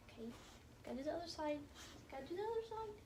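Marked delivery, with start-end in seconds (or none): 2.1-2.9 high, cooing repeat to a dog; sing-song pet talk. Real, not acted. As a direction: lift it into a cutesy pet voice.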